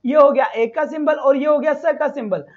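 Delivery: ordinary talk, nothing marked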